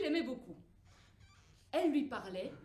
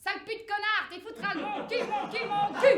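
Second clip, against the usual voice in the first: higher, louder rattled-off swearing; foul-mouthed doll; 7 semitones higher, 7 dB louder than usual. Acted. The doll swearing at the mother, high loud cursing. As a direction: spit out the curses like a rude doll. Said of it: "se met à débiter un chapelet d'horribles gros mots".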